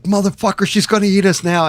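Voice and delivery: high, loud panicked shriek; terrified scream. Retold or imitated. Tripping on Aliens, screaming that the queen will eat them. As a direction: scream like the monster is on you. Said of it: "terrified, tripping, alien attacks".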